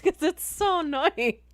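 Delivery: giggling, can't stop laughing. Real, not acted. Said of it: "laughing while reading".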